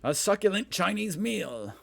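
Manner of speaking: loud, high-pitched theatrical quote; viral video quote. Retold or imitated. Host quoting a viral video, hammed-up impression. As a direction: declaim it high and loud like a famous meme. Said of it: "Is this a viral video".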